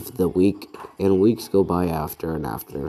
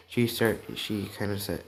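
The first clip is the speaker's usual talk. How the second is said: ordinary delivery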